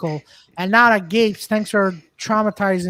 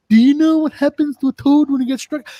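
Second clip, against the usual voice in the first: mock Storm line; 5 semitones higher, 3 dB louder than usual. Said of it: "Storm's line in X-Men".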